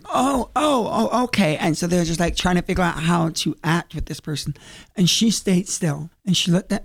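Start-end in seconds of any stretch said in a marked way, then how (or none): 0.1-1.6 high, startled imitation of nervous actors; mock flustered surprise. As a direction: play thrown-off Juliets caught off guard. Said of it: "So the Juliets were like".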